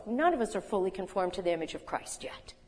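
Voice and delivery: ordinary delivery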